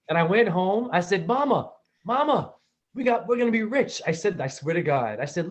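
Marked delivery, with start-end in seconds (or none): 1.3-4.0 put-on high, breathless little boy calling mama; bursting with news, much higher; excited kid. Little [name] running home to mama, high and excited.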